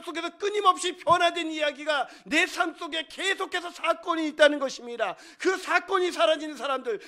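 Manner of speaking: high, loud, urgent proclamation; fervent preaching. Real, not acted. Preacher urges congregation toward living faith, loud fervent oratory. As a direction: drive each phrase home with full force.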